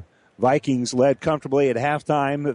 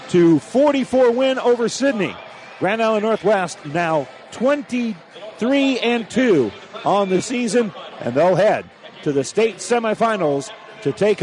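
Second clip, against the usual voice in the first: excited; 6 semitones higher than usual. Real, not acted. Final horn, broadcaster's win call.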